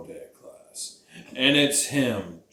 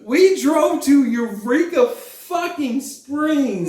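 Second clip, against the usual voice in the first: high, drawn-out exasperated protest; mock-indignant rant; 6 semitones higher, slower than usual. Real, not acted. Reminding partner of the car-buying favour, mock-indignant. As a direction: spell out each favour slowly in a raised pitch.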